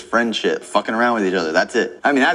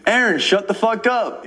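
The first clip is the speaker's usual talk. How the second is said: imagined telling off; 9 semitones higher, slower than usual. Retold or imitated. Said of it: "I'd be like".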